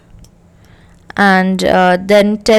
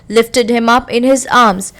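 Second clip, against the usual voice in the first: normal talking voice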